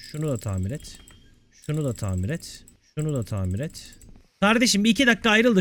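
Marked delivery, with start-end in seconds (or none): 0.1-3.9 soft, low repeated mumble; low muttering. Real, not acted. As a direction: mutter a dull chore to yourself. Repeats repair chore to himself. (alçak sesle mırıldanma)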